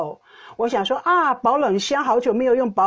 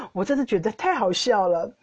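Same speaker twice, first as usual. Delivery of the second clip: ordinary delivery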